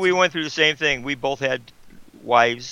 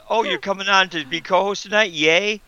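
mock excited welcome; 7 semitones higher than usual. Teasing the host by quoting her welcome, mock excited. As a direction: playfully mimic an eager host's greeting. Imitating Cheryl's earlier welcome.

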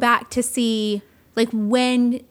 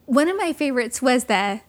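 ordinary delivery

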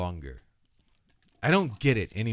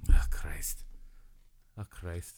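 mock emotional; 5 semitones lower, 4 dB quieter, slower than usual. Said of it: "I'm getting all emotional".

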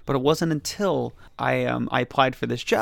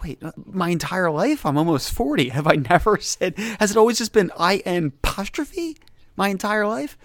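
retold disbelief; 5 semitones higher than usual. Acts out his dawning disbelief.

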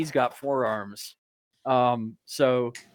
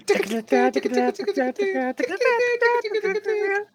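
high rapid-fire synth riff imitation; fast staccato mouth-made dance hook; mimicked techno riff. Player mimicking the Sandstorm song, high rapid synth riff.

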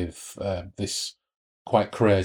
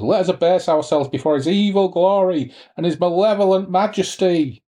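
much higher, thin and ecstatic; shrill voice; 9 semitones higher, 3 dB louder than usual. A narrator's reading. Madeline hails the devil's glory in a shrill voice. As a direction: cry it up high in rapture. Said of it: "she shrilled".

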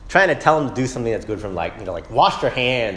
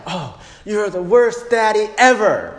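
put-on high, slow childish whine; sulky child; 8 semitones higher, 4 dB louder than usual. Angry son accusing his father, high childish whine. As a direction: whine it like an upset little boy. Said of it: "He says stuff like".

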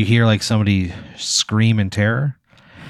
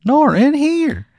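very high, loud and chirpy; a cheerful little pickle introducing himself; squeaky cartoon pickle; 4 dB louder than usual. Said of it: "you hear".

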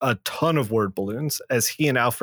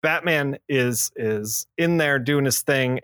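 ordinary talk, nothing marked

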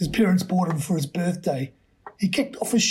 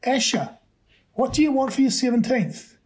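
mother's voice; 7 semitones higher than usual. A narrator's reading. Mother asks son.

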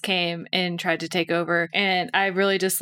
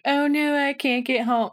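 mock whiny complaint; 5 semitones higher than usual. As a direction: whine it like a stranded child. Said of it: "a whiny person who's like".

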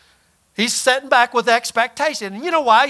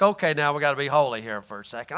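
mock prayer voice; 8 semitones lower, 7 dB quieter, faster than usual. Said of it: "you gotta put your prayer voice on".

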